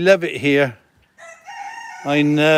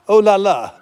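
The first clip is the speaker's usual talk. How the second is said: mock French; 5 semitones higher, 4 dB louder than usual. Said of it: "I think I am turning French".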